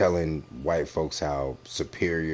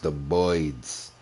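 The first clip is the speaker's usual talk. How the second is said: mock Boston accent. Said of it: "I don't know if that's a Boston or accent".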